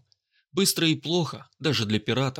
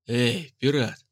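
calling out; much slower than usual. Acted. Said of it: "крикнул тип с пистолетом".

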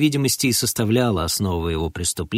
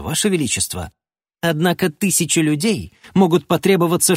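ordinary delivery, nothing marked

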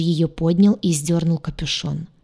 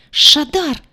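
высокий громкий возмущённый вскрик; пискни имя повыше и погромче; 9 semitones higher, 5 dB louder than usual. Acted. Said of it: "пискнула она". (пискливый возглас)

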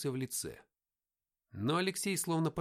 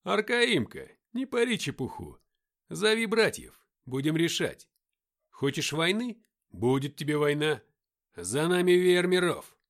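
loud, raised-pitch taunting defiance; defiant challenge; 3 semitones higher, 9 dB louder than usual. A narrator's reading. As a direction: dare him to fight, loud and unafraid. Игнат бросает вызов Аркаиму. (громко, с вызовом)

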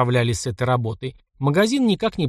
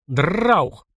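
voiced burst of automatic fire; gunfire sound; 3 semitones higher than usual. A narrator's reading. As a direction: rattle out a gun burst.

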